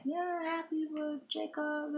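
high, slow sing-song imitation of her own happy self; mock happy self. Mother recalls her son's graduation morning, high and slow, sing-song happy. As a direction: play back how cheerful she felt that morning. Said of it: "So it went from me being like".

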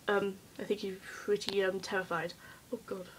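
soft, low near-whisper; hushed confession. Real, not acted. Vlogger confiding about life in her head, hushed. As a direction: drop right down as if confiding.

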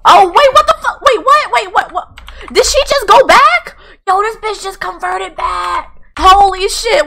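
loud, very high squealed exclamations; shocked disbelief. Real, not acted. Player seeing Sim convert right back, shocked squeal. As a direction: shriek it in stunned confusion.

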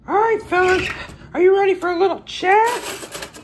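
loom over them in a squeaky pitch; a sinister stranger in a squeaky high voice; creepy stranger. Acted.

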